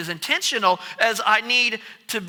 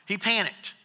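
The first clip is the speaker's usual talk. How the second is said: ordinary talk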